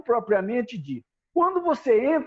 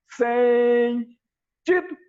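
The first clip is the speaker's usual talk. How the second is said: split drill command; much slower than usual. Shown as a demonstration. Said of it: "Esse tido é a voz de execução". (comando "Sem... tido!" com "tido" agudo e seco)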